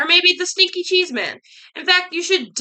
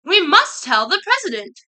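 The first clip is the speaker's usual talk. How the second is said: high, raised-voice alarm; urgent yell; 5 semitones higher, 4 dB louder than usual. A narrator's reading. Hen and duck to their friend Goosey Lucy, yelling. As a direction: call the friend with urgent news. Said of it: "and yelled".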